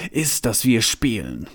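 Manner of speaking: plain reading voice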